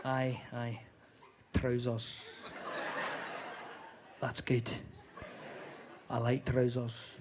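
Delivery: low, soft Scottish seducer; hushed, deep Scottish chat-up; Scottish accent. Acted. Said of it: "work out a bit of a Scottish accent".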